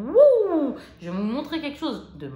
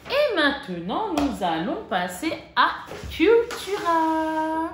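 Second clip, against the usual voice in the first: higher, louder and slow, like a show host; mock announcer; 6 semitones higher, 4 dB louder, slower than usual. A vlogger to her viewers, mock grand announcement. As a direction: announce the next segment grandly. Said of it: "announces next shop".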